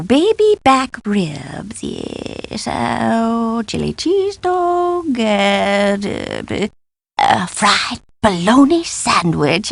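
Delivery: slow, soft, drawn-out pondering; dreamy food musing. Acted. Scholar musing over what to order, dreamy and hesitant. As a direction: linger softly over each dish.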